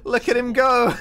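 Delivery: excited high-pitched shout; excited cheer. Real, not acted. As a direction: call it out with loud excitement. Cheering on fast gameplay.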